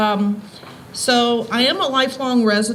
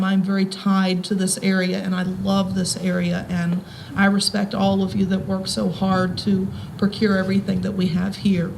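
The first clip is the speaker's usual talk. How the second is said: ordinary talk, nothing marked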